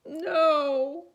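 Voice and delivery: high, loud wailing no; cried out. Acted. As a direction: cry it out in protest. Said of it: "cried Jessica".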